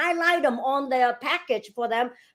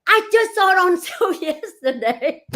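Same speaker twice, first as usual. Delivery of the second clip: incredulous laugh; 9 semitones higher, 6 dB louder than usual. Retold or imitated. House vanished from market.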